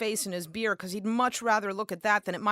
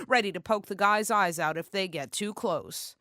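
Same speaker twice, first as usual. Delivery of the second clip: ordinary delivery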